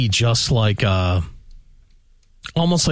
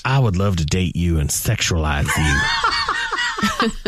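mock flirt; 5 semitones lower than usual.

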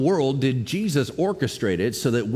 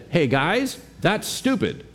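casual paraphrase; 6 semitones higher, slower than usual. Retold or imitated. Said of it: "Let me paraphrase".

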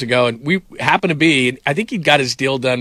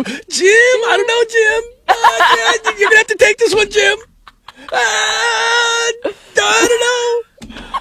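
much higher, crying mock wail, drawn out at the end; Tony Romo impression; slower than usual. Mocking Tony Romo on air, helplessly wailing to Jim. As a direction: ham up a lost broadcaster begging his partner. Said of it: "Tony Romo on air".

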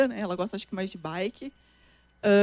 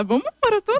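eager invitation; 10 semitones higher, faster than usual. Retold or imitated. Retells inviting girlfriend to a marathon.

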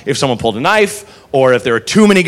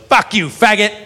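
put-on nasty drunk insult; 4 semitones higher than usual. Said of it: "the worst thing ever, which was".

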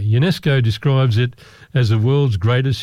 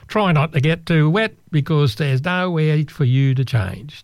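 put-on slow, higher Canadian accent, tour guide; a Canadian tour guide's warning, slower; 4 semitones higher than usual. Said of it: "That's my Canadian accent".

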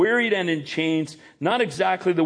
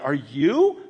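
"you" swept sharply upward; rising, disbelieving push on "you"; stress on YOU; 2 semitones lower than usual. Shown as a demonstration. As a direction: question it with all the weight on "you". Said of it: "emphasis on the word "you".

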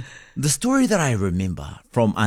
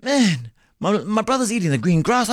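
excited little goat; 9 semitones higher than usual. Retold or imitated. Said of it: "looked over and goes".

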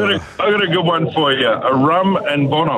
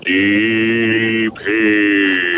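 low, long-held words; deep baritone on request; 4 semitones lower than usual. Pretend. Caller showing off for radio hosts, deep baritone. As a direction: draw out each word in your deepest voice. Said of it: "Can you give us a deep heat".

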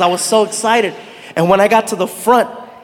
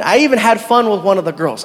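ordinary talk, nothing marked

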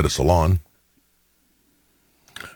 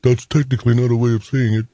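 higher-pitched, lightly mocking quote; imitated nitpicking colleague; 6 semitones higher than usual. Danny correcting the host about the time, imitated nitpicking. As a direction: do a pedant correcting someone. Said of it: "He's like".

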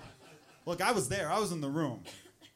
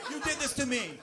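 mock yell; 9 semitones higher than usual. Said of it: "they're yelling at each other".

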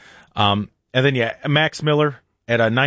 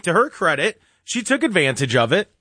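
usual speaking voice

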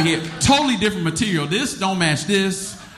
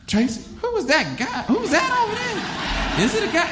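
put-on much higher, gossiping girl voices; curious girls. Party girls asking Tracy about the hooded guy, high gossip. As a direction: mimic the neighborhood girls asking. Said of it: "They like".

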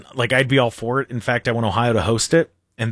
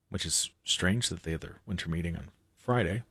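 much softer, lower wry aside; muttered aside; 8 semitones lower, 13 dB quieter than usual. Real, not acted. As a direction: drop it under your breath. Wry aside about meeting day.

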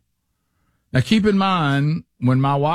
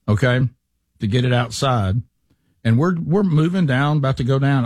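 normal talking voice

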